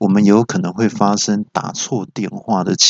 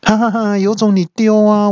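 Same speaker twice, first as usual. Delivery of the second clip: taunting voice assistant; 10 semitones higher than usual. Acted. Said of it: "然后语音就回说".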